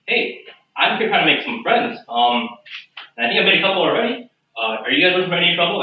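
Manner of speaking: lilting, hurried peacemaking; friendly overture. Acted. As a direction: talk them down in a lifted pitch. Player placates the hostile patrons.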